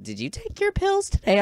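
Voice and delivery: very high, squeaky questioning voice; mock teacher voice. Teacher staring at the excited student, squeaky mock concern. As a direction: imitate a teacher in shrill falsetto.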